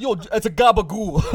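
high, loud Italian-American gag voice; Italian deli guy. Acted. Deli man naming the meat, hammed-up Italian accent. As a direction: crack up as a cartoon Italian counterman. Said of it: "weird fucking Italian at a deli".

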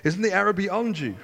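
called out high across a field; calling out. A narrator's reading. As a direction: call after the running boy. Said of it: "Jonathan called out after him".

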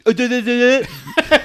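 loud, high duh-duh noises; childish mock drowning-out; mocking babble. Streamer blocking Louis's points, mocking babble. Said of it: "the equivalent of going like".

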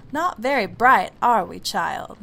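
loud, mocking know-it-all voice; haughty ceiling voice. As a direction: sneer down at the child. Ceiling voice taunts the girl.

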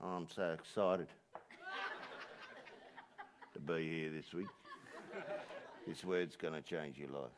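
low, quiet, laughing monotone; flat sarcastic parody of a bored preacher; deadpan mock excitement. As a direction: fake enthusiasm with no energy at all. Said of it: "And go".

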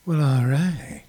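low, silky jazz DJ drawl; smooth jazz voice. Shown as a demonstration. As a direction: croon it like a smooth jazz host. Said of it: "like we're doing smooth jazz".

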